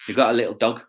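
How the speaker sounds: usual speaking voice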